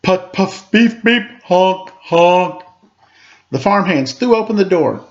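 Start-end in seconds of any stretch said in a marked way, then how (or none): 0.0-2.6 tractor horn. A narrator's reading.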